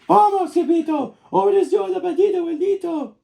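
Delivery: high, loud frightened shouts; panicked cry. Acted. Chihuahuas flee the shadow, high and frightened. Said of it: "they cried".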